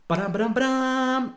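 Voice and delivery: high, mock drumroll. Building suspense for listeners with a drumroll. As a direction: tap it out like a drum.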